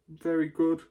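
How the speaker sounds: ordinary delivery, nothing marked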